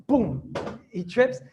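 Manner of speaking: drawn-out slow storytelling beat; slow dramatic boom. Retold or imitated. Teacher dramatizing the child tripping, slow and drawn out. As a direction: stretch it out like a fall.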